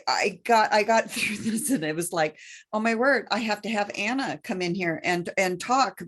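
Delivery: ordinary talk, nothing marked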